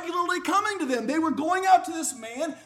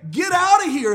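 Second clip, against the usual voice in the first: loud, higher shouted rebuke; bold callout; 5 semitones higher, 10 dB louder than usual. Retold or imitated. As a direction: throw them out with a loud, raised cry. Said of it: "the audacity to say".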